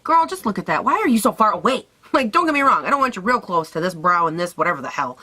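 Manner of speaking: normal talking voice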